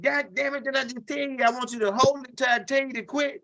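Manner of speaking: high, hammed-up scolding; mock angry. Cousin scolding little man for letting go, mock angry. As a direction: act out an old man fussing. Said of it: "Cousin Jit got mad".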